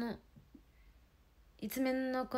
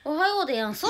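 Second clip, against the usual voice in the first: おはようでやんす said louder, stress on は; louder mock greeting; louder take; 5 semitones higher, 9 dB louder, faster than usual. Shown as a demonstration. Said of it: "何言うんだろう、ひなこ".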